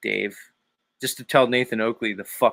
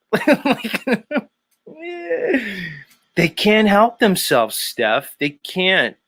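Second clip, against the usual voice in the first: ordinary delivery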